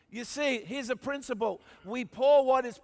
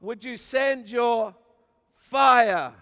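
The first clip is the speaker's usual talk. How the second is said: slow plea with fire shouted louder; loud prayer cry; 6 dB louder, slower than usual. Retold or imitated. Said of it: "And then he said".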